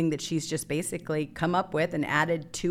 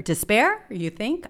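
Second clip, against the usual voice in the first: sarcastic scoff; 7 semitones higher, 4 dB louder, slower than usual. Real, not acted. Mocking the Democrats' excuse.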